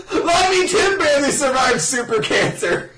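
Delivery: high-pitched laughing exclamation; hammed-up incredulity, half laughing; mock amazement. Joins in mocking the plot.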